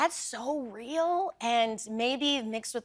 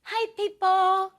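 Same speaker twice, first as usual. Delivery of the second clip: put-on sweet toddler voice; 8 semitones higher than usual. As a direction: chirp it like a friendly toddler. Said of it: "He's like".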